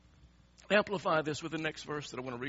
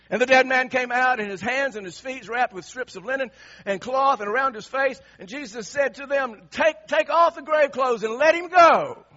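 loud, high, climactic scripture reading; full-force preaching; 9 semitones higher, 13 dB louder than usual. Acted. Preacher proclaims Lazarus rising to congregation, loud and triumphant. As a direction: proclaim it at full voice, building to the final command.

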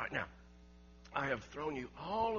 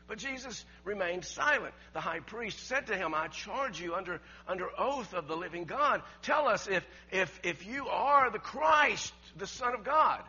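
preaching at full force; 5 semitones higher, 11 dB louder than usual. Acted. Preacher reading the trial scene to the congregation, loud and intense. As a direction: thunder out the high priest's charge. Preacher reads the high priest.

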